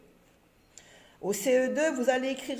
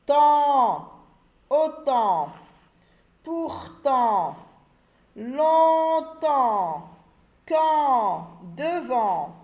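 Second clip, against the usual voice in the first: loud words, each one alone; syllable drill; 3 semitones higher, 6 dB louder, much slower than usual. Shown as a demonstration. Teacher reads the word list to the pupils, slow and loud. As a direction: read the word list slowly for pupils to repeat.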